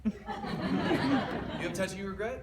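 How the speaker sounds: delighted disbelief; laughing surprise. Real, not acted.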